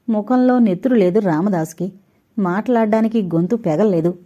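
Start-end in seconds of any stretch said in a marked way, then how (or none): none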